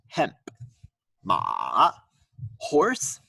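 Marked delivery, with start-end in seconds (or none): 1.2-1.9 ma falling low then rising; dipping tone. Shown as a demonstration. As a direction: dip ma down and bring it up. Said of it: "Sing along at home".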